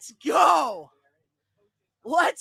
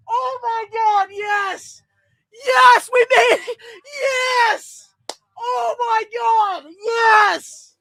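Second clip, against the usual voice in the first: high cheering yells; overjoyed fan cheering; excited shouting; 10 semitones higher, 3 dB louder, slower than usual. Real, not acted. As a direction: cheer like you can't believe it. Fan celebrating draft pick.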